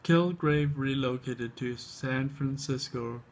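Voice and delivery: ordinary talk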